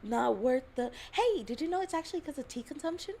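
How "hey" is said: ordinary talk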